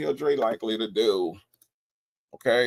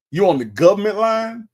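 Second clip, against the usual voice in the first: higher, louder GOVERNMENT LINE, rising; incredulous question; 6 semitones higher, 5 dB louder than usual. Real, not acted. Host questioning Nesto on the government line, incredulous. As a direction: lift "government line" up in loud disbelief. Repeats caller's claim, more incredulous.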